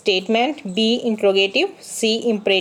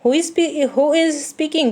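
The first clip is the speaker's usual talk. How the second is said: mock phone answer; 6 semitones higher, much slower than usual. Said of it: "तो हम क्या बोलते हैं".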